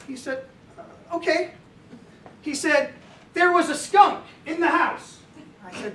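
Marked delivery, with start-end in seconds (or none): 0.8-1.5 put-on higher, small reluctant-child reply; lightly voiced little boy; young son. Nine-year-old answering dad's story offer, lightly voiced young son. 3.3-5.2 put-on higher, louder little-boy voice; young son storytelling. Son telling dad a bedtime story, imitated young storyteller.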